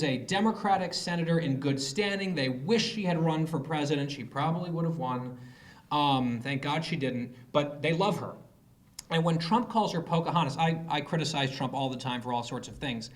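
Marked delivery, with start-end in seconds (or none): none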